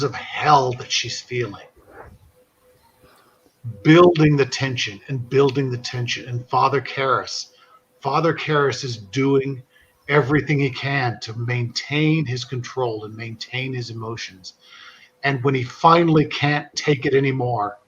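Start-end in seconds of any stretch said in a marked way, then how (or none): none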